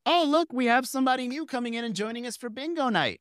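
host voice. Retold or imitated. Bingo host welcoming the new guy in. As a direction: announce him like a camp emcee. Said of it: "when she goes".

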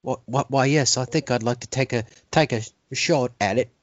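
Harold Pinter. Pretend. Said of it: "Hey, Harry".

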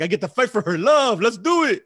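hyped-up retold inner voice; all excited. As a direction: pitch it up, raring to go. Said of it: "I'm all excited".

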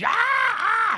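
high frightened scream; screaming lady. Retold or imitated. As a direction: scream like a startled old lady. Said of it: "she's screaming".

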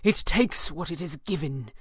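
a touch of desperation, slightly held in; understated desperate insistence. A narrator's reading. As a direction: press the warning home, mildly desperate. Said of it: "Falcone cried desperately".

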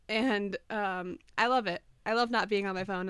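ordinary talk, nothing marked

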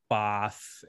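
"Both" said mom's odd regional way; mom's odd pronunciation. Retold or imitated. Son quoting his mom's weird words, "Both" in her pronunciation. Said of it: "My mom says all types of weird shit".

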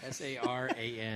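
slow letter by letter spelling. Shown as a demonstration. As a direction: spell each letter slowly and clearly. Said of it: "how do you spell that?".

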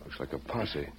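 soft, slow hushed voice. Acted. Hiding, tells horse stay quiet.

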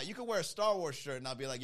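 ordinary delivery

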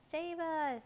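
high, drawn-out plea for rescue; frightened cry. Acted. Three figures fleeing the dragon, high frightened cry. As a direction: call out high while fleeing. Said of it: "they cried as they ran".

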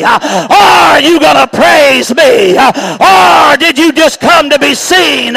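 preacher driving the church toward worship, shouting; preaching at full force. Acted. Preacher calling his church to true worship, full-force preaching cry.

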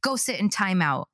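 high, mock-scolding parent voice. Parent scolding toddler for hitting baby, high scold. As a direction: play the shocked scolding parent. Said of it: "a lot of parents would be like".

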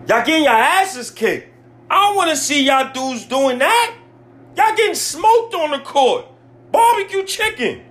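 very high, exasperated outburst; real angry rant. Real, not acted. Fan berating players getting smoked, high angry rant. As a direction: let the voice climb as you vent. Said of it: "if I'm screaming on this".

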